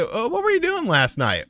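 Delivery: questioning detective. Retold or imitated. Detective asking for an alibi, lightly mimicked. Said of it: "He was like".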